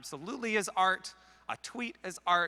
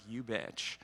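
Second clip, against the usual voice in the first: low, soft mock mutter under the breath; a quiet mock curse from the audience; muttered insult; 8 semitones lower, 8 dB quieter than usual. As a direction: grumble it quietly, half joking. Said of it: "I was like".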